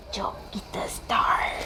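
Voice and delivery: soft slow ASMR whisper. Real, not acted. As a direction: breathe it out in a whisper. Starting an ASMR mukbang.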